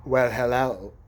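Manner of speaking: low, put-on come-on; low mock-seductive greeting; imitated flirty man. Retelling drugged man's flirty greeting.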